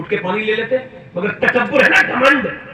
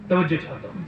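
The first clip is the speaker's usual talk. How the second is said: normal talking voice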